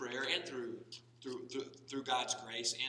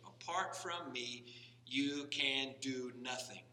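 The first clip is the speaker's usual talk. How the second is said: slow, weighty emphasis; much slower than usual. Shown as a demonstration. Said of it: "what a statement".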